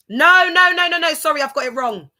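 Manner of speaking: high, loud burst of no's; loud self-correction. Real, not acted. Realising the family tree is wrong, loud self-correction. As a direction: cry out to stop yourself. Catches own mistake mid-explanation.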